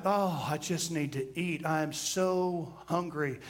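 a hint of a hungry whine; whiny hungry complaint. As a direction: groan it like you're starving. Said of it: "we were like".

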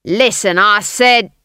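loud, forceful guard. A narrator's reading. Guard stopping Granny, loud insistent role voice. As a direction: cut in loudly as the guard. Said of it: "the guard began".

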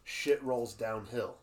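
slow, drawn-out proverb; slow saying. Retold or imitated. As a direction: drag the saying out slowly. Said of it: "you just say".

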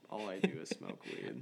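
low, dopey stoner drone. Pretend. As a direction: drop low like a burnout. Mocking stoners, deep drop.

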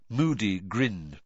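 ordinary delivery

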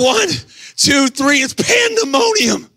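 high shouted wrestling count; excited pin count. Retold or imitated. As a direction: build from a count to a soaring, high-pitched cry. Acts the pin count and uproar.

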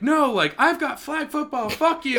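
high, mocking grumpy neighbor voice; mock the neighbor's whiny refusal. Neighbor refusing the party invite, high mocking grumble. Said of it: "And he's like".

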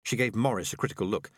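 ordinary delivery

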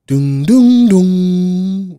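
loud, high mock trumpet blast "doom, doom, doom"; a burst of peacock feathers, trumpeted out; booming fanfare. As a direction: blare it like a triumphant fanfare.

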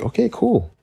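put-on high little-kid okay; kid self. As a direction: chirp it like a happy kid. Child voice answering mom.

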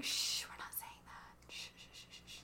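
shushing in a soft whisper. Real, not acted. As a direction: hush her in a whisper. Hushing talk of failure.

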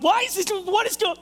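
acted high-pitched frantic complaint; exasperated outburst. Stuck traveler complaining about the boarding hold-up, exasperated. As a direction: blurt it high in mock exasperation, breaking off.

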